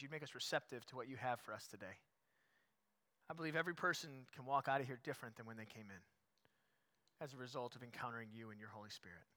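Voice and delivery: soft, low-falling prayer voice. Real, not acted. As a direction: pray quietly and reverently, with pauses between phrases. Leads the congregation in prayer.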